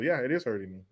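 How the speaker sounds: normal talking voice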